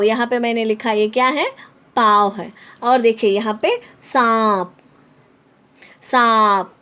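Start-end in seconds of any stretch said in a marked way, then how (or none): 4.0-6.7 "सांप" said with the air through the nose on the nasal vowel; nasal vowel demo. Shown as a demonstration. Teacher shows pupils how "सांप" sounds through the nose. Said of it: "मेरी आवाज़ अलग से आ रही है".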